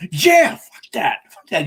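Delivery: high, loud, drawn-out yeah, then easing; riled-up cheer. Acted. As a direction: act out a fan getting fired up. Voicing a riled-up viewer's reaction.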